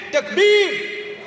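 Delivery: high, loud rallying call; takbir shout. Real, not acted. Preacher rousing the congregation, high loud call. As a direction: cry it out to rouse the crowd.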